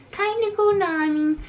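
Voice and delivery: quoted voice. Retold or imitated. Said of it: "So I was like".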